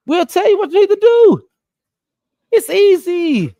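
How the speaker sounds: pumped-up pitch to the coach; hyped-up lecture. Acted. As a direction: sell it like it's obvious and easy. Mock-preaching advice to the coach.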